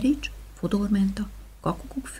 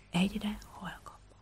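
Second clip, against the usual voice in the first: fading to a whisper; 11 dB quieter than usual. Shown as a demonstration. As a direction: fade the line right down to a whisper. (elhaló suttogás)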